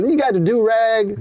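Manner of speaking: high, slow, lightly voiced street comic; imitating a Def Comedy Jam comic; comic on stage. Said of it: "the guy goes".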